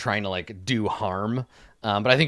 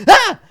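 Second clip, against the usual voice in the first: high, loud bird-like squawk; short startled shriek of a kid playing bird; 13 dB louder than usual. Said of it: "he squawks".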